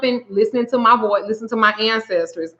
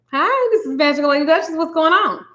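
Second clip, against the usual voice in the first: higher, quick phone hello; phone greeting; 6 semitones higher, faster than usual. Retold or imitated. Reader greets a client on the phone, high and quick. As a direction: answer brightly in a raised pitch.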